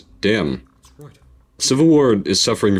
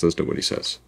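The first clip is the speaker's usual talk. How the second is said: whisper; 6 dB quieter, faster than usual. Real, not acted. Whispered repeat of the line.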